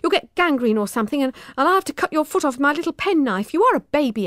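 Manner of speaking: a touch of sharp impatience; sharp temper. A narrator's reading. Poppy losing her temper with Emma, mildly sharp. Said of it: "lost her temper and said sharply".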